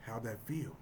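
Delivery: low taunt. Retold or imitated. Taunting punchline.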